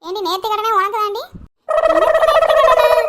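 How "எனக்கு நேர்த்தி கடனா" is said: put-on high, loud, slow shocked child cry. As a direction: gasp it out as a stunned little girl. Girl hears she gets shaved.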